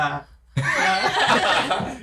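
high, strained little cry-gasps, half laughing; silent crying gasps. Retold or imitated. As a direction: imitate stifled sobs after a spanking. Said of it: "you cry silently".

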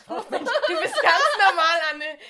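laughing loudly through the words. Real, not acted. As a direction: burst out laughing mid-sentence. Teasing friend then laughing.

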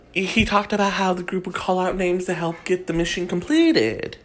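ordinary talk, nothing marked

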